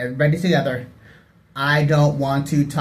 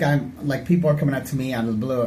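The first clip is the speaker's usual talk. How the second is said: usual speaking voice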